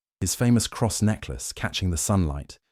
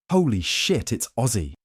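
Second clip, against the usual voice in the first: higher, louder startled cry; excited shout; 5 semitones higher, 3 dB louder than usual. A narrator's reading. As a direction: blurt it out in thrilled surprise. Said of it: "one of them shouted".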